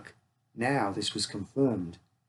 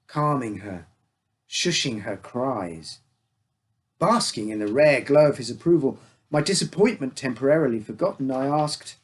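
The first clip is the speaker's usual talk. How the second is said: ordinary delivery, nothing marked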